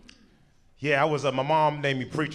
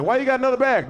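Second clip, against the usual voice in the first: high-pitched mock questioning; questioning passenger; 7 semitones higher, faster than usual. Fellow passenger questions his extra bag, high mock voice. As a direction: imitate a nosy passenger, pitched well up. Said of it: "They're like".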